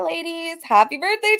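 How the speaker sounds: ordinary talk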